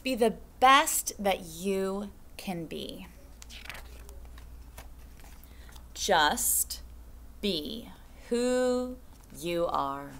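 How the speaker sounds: hushed, slow storybook read. Acted. Teacher closing the book for second graders, hushed. Reads picture book to class.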